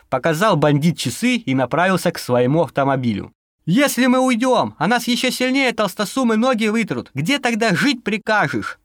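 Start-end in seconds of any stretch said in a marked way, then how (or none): 3.6-8.8 shouted after. A narrator's reading. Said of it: "крикнул преступнику вслед".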